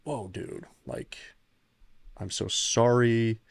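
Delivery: hushed, slow "I'm so sorry"; half-whispered shock, then slow heartfelt sorry. Retold or imitated. Shocked sympathy retold to stranger.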